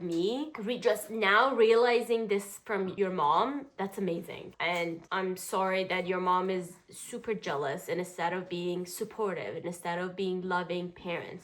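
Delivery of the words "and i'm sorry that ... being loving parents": low, soft, sincere sympathy; serious, quiet therapist delivery. Real, not acted. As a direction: counsel gently in a lowered voice. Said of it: "You're so serious".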